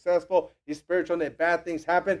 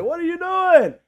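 high, loud imitated scolding; holier-than-thou outrage; 13 semitones higher, 8 dB louder than usual. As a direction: burst out in mock indignation. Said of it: "got holier than thou".

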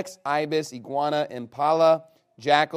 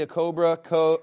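normal talking voice

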